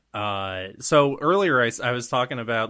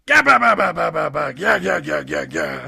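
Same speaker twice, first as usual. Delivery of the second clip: booming giant laugh; 5 semitones higher, 6 dB louder than usual. Acted.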